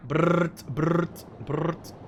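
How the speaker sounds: playful mouth-made machine gun bursts; gunfire noise. Pretend. Player cheering his helicopters' guns, mock brrt bursts. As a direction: buzz the lips like a rotary cannon.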